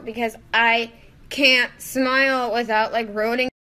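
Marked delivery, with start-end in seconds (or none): none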